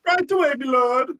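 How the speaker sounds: squeaky high servant voice; obsequious. Acted. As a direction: grovel eagerly to your master. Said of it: "I love Bartholomew".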